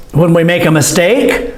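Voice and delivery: normal talking voice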